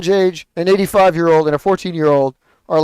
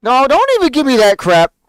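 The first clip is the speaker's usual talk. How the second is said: higher, louder scoff at a lame excuse; indignant rant; 7 semitones higher, 5 dB louder than usual. Acted. Ranting at people who hide behind covid, fed-up scoff. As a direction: throw the excuse right back, annoyed.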